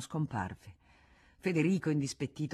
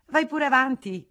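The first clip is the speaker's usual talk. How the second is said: high, louder, airy dismissal; Rosanette's voice; 10 semitones higher, 10 dB louder than usual. Acted. Rosanette sends Frédéric ahead down the corridor, high and airy. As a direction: wave him on carelessly. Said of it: "lei disse".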